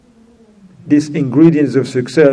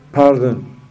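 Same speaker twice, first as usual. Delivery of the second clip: usual speaking voice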